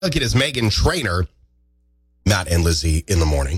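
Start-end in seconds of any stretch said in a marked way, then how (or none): 2.3-3.6 lower, announcer-style show name; radio station ident. Acted. Host announcing the show, lower announcer voice. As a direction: boom out the show name like a jingle.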